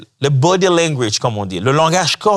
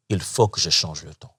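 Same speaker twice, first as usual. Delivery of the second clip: hushed self-talk; 8 semitones lower, 7 dB quieter than usual. Retold or imitated. Inner resolve, hushed.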